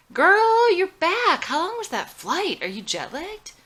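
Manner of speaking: put-on high, loud party-girl squeal; bubbly Lane. A narrator's reading. Lane calling from the beer garden, bubbly and excited. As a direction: gush like a tipsy party friend. Lane the fun-loving friend voiced.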